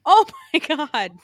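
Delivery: high, loud, drawn-out laughing shock; shocked laughter. Real, not acted. Co-host seeing the picture for first time, shocked laughing. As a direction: burst out laughing in disbelief.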